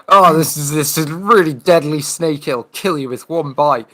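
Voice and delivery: loud, high mock Aussie khaki-hunter gush; Steve Irwin impression. Mocking Steve Irwin for a friend, high and loud. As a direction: ham up the croc hunter's excitement.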